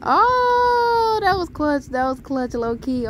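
high, loud drawn-out "oh" that gradually settles; excited whoop. Real, not acted. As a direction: whoop high, then come down in approval. Reacting to a joke in the game.

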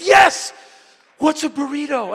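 high, loud thrilled yes; eager Kim. Retold or imitated. Kim thrilled at the invite, then puzzled, high and loud. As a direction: jump at the offer, then puzzle. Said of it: "And he said".

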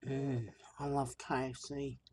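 ordinary delivery

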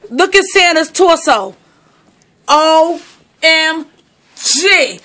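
ordinary talk, nothing marked

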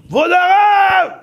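high, slow, loud cry to the rabbi; alarmed visitor yelling for the rabbi; shouted call. Retold or imitated. Philosopher bursts in on rabbi.

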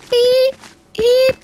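high, loud beep beep; robot beeps. Acted. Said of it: "I am a robot".

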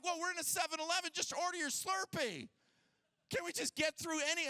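high-pitched mock exasperation; impatient pleading. Husband to wife in seven eleven, acted impatient pleading. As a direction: urge her along, mock-impatient and hurried.

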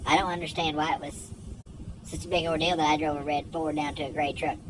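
normal talking voice

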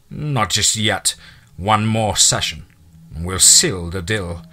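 slow and deliberate, unbothered; slow cold refusal. Acted. Captor denying the prisoner's plea, slow cold refusal. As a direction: let each word hang, cold.

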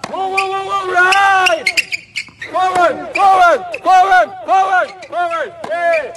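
high, loud yelled commands; yelling. Shown as a demonstration. Coaching trainee told to yell at him, yelling. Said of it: "you gotta yell at him".

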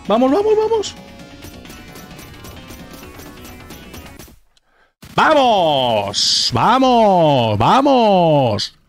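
shouting vamos in triumph; victory shout. Real, not acted. Acierta al jefe con el shuriken. (grito de victoria)

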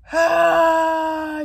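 high, long loud sighing "hah". Real, not acted. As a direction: let out a big voiced sigh. Overwhelmed after talk of friend.

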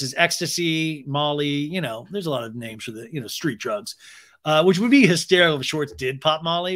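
ordinary delivery

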